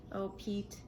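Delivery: plain reading voice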